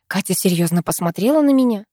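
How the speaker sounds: ordinary delivery, nothing marked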